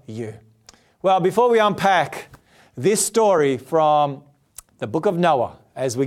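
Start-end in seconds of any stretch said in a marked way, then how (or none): none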